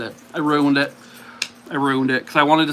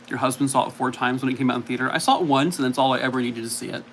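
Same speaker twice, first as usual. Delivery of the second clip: ordinary talk, nothing marked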